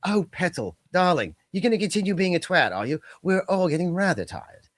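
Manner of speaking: lightly voiced, sweetly condescending British lady; posh British put-down. Host showing how Brits insult politely, mild British accent. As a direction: purr the insult like a polite English auntie. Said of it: "But when you're like".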